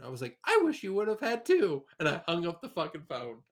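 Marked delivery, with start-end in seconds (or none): 0.4-1.8 cutting retort. Retold or imitated. Cutting reply to mother.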